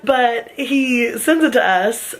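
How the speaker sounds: usual speaking voice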